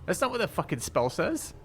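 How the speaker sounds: high indignant outburst; outraged protest. Real, not acted. Outraged at game rule.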